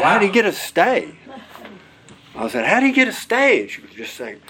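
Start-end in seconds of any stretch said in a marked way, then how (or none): 0.0-1.1 much higher and louder, urgent disbelief; shocked question. Retold or imitated. Shocked at sudden stay news. 2.7-3.6 much higher and louder, urgent disbelief; shocked question. Retold or imitated. Repeating in disbelief.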